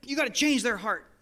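exasperated demand, retold; frustrated prayer. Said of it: "I was frustrated".

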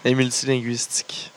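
whispered through a laugh. Real, not acted. Joking with friends about Rusev's languages, laughing whisper. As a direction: breathe it out while laughing, almost no voice.

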